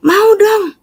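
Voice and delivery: high eager mother voice; mother asking for baptism, high and eager; mom eager. Retold or imitated.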